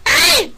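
falsetto ay shriek; piercing high yelp. Real, not acted. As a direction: shriek in sudden shock. Sudden scream at game.

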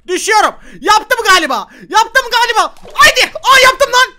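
high, loud, climbing excitement; thrilled shouting. Real, not acted. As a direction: scream as you realise you made it. Düşerken başardığını anlıyor. (sevinçten tiz çığlıklarla bağırma)

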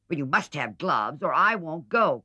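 firm and insistent, loud on the ultimatum; decided cry. A narrator's reading. Meg insisting to Jo that she must have gloves, firm and loud. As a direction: lay down the law to your sister. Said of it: "cried Meg decidedly".

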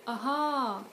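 "Aha" with a long stressed second syllable, pitch arching; last-syllable stress. Shown as a demonstration. Listener receiving news, "Aha" stressed on the last syllable. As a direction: lean hard on the end of "Aha". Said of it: "stress the last syllable instead".

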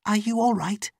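very high, concerned question; high female character voice, rising in concern; concerned servant woman. Acted. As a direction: ask anxiously after her through the door. Layla asks once more.

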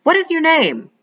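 high sly witch call. A narrator's reading. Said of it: "Suddenly she calls".

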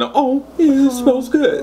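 high, sing-song fake "Oh, yeah, it smells good"; mock fake praise. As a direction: squeal it like a phony compliment. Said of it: "Don't be saying".

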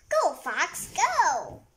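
loud, high cheer. Real, not acted. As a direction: urge the fox on. Child cheering fox.

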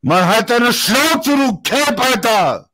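slow, high, loud furious yelling; furious shout. Real, not acted. Shouting down his friend during a heated fight, furious yelling. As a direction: spit each word out at him in rage.

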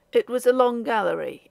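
usual speaking voice

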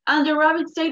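plain reading voice

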